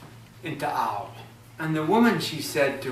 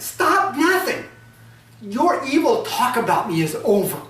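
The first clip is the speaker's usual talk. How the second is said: angry wife; 9 semitones higher, 5 dB louder than usual. Acted. Ogress wife rages at her lying husband, loud. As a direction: slam the door on his pleas. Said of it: "the anger of the woman".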